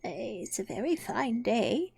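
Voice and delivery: slow, whispery, hesitant small talk; timid. A narrator's reading. Rabbit peeping anxiously at Alice, timidly. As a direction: venture it nervously, barely voiced. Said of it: "said a timid voice".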